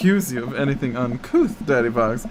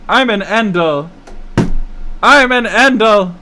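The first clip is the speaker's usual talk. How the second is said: loud, slow, high villain boast; hammed-up declaration; 8 semitones higher, 10 dB louder, slower than usual. Gloating at the rival wife, loud and slow. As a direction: belt it out like a proud homewrecker. Player hams up villain line.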